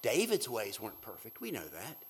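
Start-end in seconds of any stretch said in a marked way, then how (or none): none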